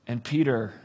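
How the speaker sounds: ordinary talk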